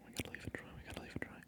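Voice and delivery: ordinary delivery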